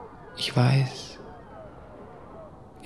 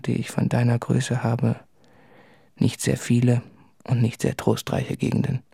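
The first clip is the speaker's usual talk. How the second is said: usual speaking voice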